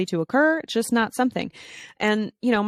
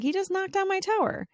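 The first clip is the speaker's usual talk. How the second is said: put-on high, small-girl voice; lightly voiced little girl telling on a boy; a hint of a hurt young daughter reporting to mom; daughter complaining; 12 semitones higher than usual.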